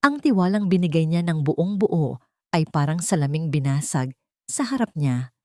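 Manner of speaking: usual speaking voice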